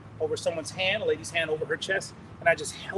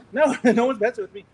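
laughing; 3 semitones higher, 7 dB louder than usual. Real, not acted. Laughing at own story.

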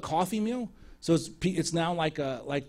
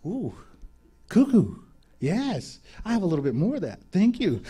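delighted. Retold or imitated. Delighted at the chicken.

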